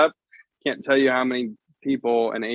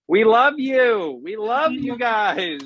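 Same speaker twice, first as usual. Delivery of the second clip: gushing affection; 10 semitones higher, slower than usual. Real, not acted. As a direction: gush it out slowly, way up high. Playful gushing send-off to viewers.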